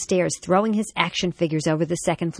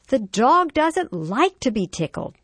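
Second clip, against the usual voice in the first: slow, higher-pitched, emphatic motherly correction; scolding mom; 8 semitones higher, 3 dB louder than usual. A narrator's reading. Mom to son on the bottom step, exasperated scolding. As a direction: spell it out firmly for a small child.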